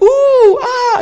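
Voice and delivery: high squealing ooh and ah, mock crowd delight. Preacher imitating zoo crowd's reactions, a high delighted coo. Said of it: "you start to see people's reactions".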